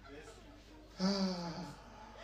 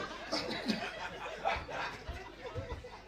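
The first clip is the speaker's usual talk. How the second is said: high laugh; 9 dB quieter than usual. Real, not acted. Laughing after go go go.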